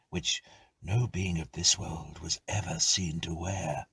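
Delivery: usual speaking voice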